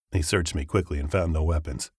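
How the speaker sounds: plain reading voice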